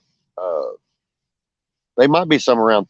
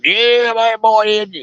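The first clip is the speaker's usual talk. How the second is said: imitating an old Tennessee man, much higher and louder; Old Man Dave; 12 semitones higher, 4 dB louder, slower than usual. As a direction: pitch way up and play the old fishing buddy from Tennessee. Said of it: "one of them guys that talks like that".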